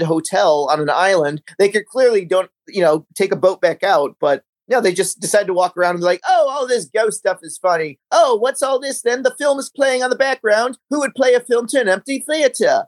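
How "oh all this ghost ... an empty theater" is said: higher-pitched, puzzled British voice; British accent put on for a joke; mock British accent. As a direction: imitate a baffled British film character. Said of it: "the British accents are just so".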